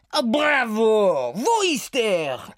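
Satie ruft begeistert mit Akzent; französischer Akzent, begeistert. Acted. (Satie lobt Oda, begeistert mit französischem Akzent)

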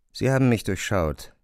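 ordinary talk

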